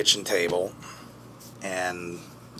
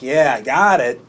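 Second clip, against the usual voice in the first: calling back; 2 semitones higher, 9 dB louder than usual. Acted. Turning round to answer his brother, calling back. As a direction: call back proudly over your shoulder.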